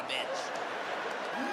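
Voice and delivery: usual speaking voice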